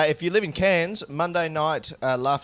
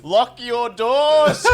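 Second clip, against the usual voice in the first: high, loud, very slow mock announcement; mock megaphone warning; 13 semitones higher, 10 dB louder, much slower than usual. Mocking the guest's return as a city-wide warning, slow and loud. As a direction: boom it like a town warning. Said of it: "we heard it through a megaphone".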